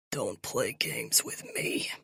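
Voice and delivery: quiet villain warning; menacing whisper. Acted. Captor warns menacingly.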